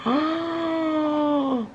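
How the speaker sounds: long, high drawn-out "oh" of dismay; dismayed wail. Real, not acted. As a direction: let out a sustained high wail of exasperation. Dismayed at her upload speed.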